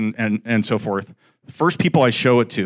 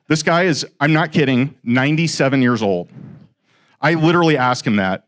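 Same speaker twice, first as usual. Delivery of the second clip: ordinary talk, nothing marked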